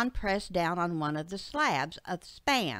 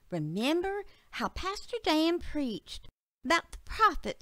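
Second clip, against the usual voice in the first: put-on higher, earnest child voice; little girl Betsy; 6 semitones higher than usual. Betsy argues from a sermon with her dad, high girlish voice. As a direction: reason it out like a young girl.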